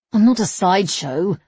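ordinary delivery, nothing marked

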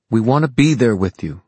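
loud, high insistent plea. A narrator's reading. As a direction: insist loudly, clearly angry. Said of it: "Ty was speaking loudly".

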